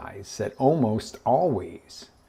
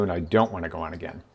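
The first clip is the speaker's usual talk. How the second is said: low, softer, quick dismissal; unenthusiastic refusal; 6 semitones lower, 5 dB quieter, faster than usual. Acted. Rider who has had enough, unenthusiastic refusal. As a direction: decline it with lowered pitch and no excitement. Unimpressed rider declines another go.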